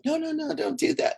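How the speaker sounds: high mock scolding of a cat. Retelling shooing her cat out of the video, mock scolding. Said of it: "uh, be like".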